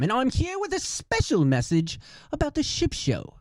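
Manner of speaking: high, mock-formal announcer voice; announcer read. Host warning easily offended viewers, mock-formal announcer read. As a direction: deliver the disclaimer like a polished promo. Recorded intro disclaimer message.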